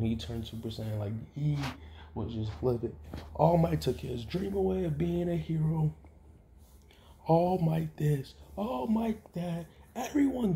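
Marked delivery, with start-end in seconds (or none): 3.3-10.5 furious rant. Acted. Deku ranting in a rage.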